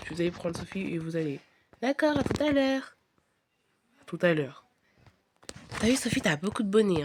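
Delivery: ordinary talk, nothing marked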